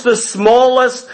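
usual speaking voice

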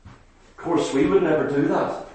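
a quiet sarcastic murmur to the congregation; dry ironic aside. Real, not acted. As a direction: drop it to a sly undertone. Ironic aside after Jonah's complaint.

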